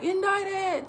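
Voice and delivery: Indicted thrown up high in a mock panic; Jim Carrey's Indicted. Mother starting the morning joke for her daughter, mock panic. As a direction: copy the movie's frantic cry. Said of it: "I would say it the way he said it".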